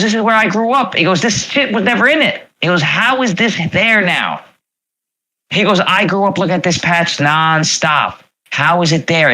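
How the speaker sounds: a friend asking how this can be; amazed disbelief. Retold or imitated. Guy quoting his friend, voice climbing in amazement.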